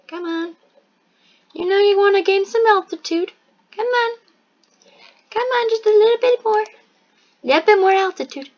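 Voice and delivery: high, pleading coax to the aircraft. Real, not acted. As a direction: sweet-talk the plane up, pitched high. Coaxing the aircraft to climb.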